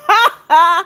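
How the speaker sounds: quick burst of laughter; amused laugh. Real, not acted. Gifts from Alex keep coming, amused laugh. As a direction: chuckle out loud in disbelief. Gift count keeps climbing.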